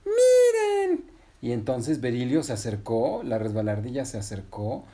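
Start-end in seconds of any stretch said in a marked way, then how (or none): 0.1-1.1 high, loud squeal; shrieky storybook excitement; excited call. Acted. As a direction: call friends over to see a discovery. Patinina calls friends to look.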